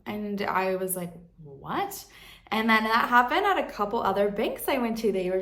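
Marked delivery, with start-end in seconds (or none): 1.4-2.0 retold disbelief. Retold disbelief at bank's refusal.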